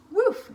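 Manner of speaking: high, loud playful woof; dog bark. Acted. Dog barks in story.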